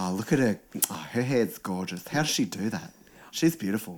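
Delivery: put-on quiet gushing girl voice; chick voice. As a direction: softly gush over her looks. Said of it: "Like my chick voice".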